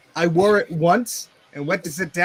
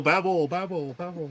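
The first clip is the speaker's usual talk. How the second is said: echo fade; 5 dB quieter than usual. Pretend. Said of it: "announcer voice".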